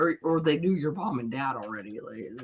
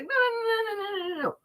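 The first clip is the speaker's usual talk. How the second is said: high-pitched rapid string of protests; mock panicked pleading. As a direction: babble it high to stop the bouncers. Retold plea to bouncers.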